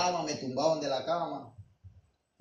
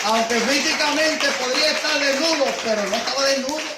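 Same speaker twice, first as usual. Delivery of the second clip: much higher, much louder proclaiming cry; preaching shout; 11 semitones higher, 14 dB louder than usual. Real, not acted. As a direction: cry it out to the congregation. Preacher bursts out in sermon.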